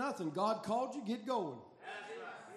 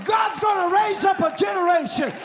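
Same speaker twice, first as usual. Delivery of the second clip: loud, driving revival sermon delivery; impassioned preacher, rising to shouts; full-force preaching; 4 semitones higher, 11 dB louder than usual. Real, not acted. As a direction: preach it hard, stretching the big phrases.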